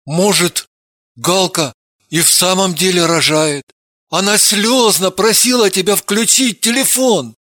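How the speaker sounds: hesitant, bewildered male voice; mildly confused; flustered babble. A narrator's reading. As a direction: falter uncertainly, with pauses. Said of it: "Он растерянно пролепетал".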